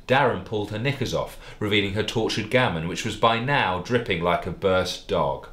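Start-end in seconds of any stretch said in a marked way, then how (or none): none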